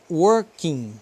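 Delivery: slow "working" ending in a nasal ng; silent final G. Shown as a demonstration. Teacher reading the written pronunciation, silent G in "working". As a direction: draw out "working" with no hard g. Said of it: "a gente não pronuncia o G".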